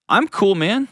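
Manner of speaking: husband brushing off the worry, offhand; casual shrug. Retold or imitated. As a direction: toss it off like it's no big deal. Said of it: "when he says".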